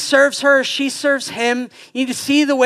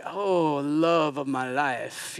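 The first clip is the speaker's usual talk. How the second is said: mock swooning; 8 semitones lower, 9 dB quieter, much slower than usual.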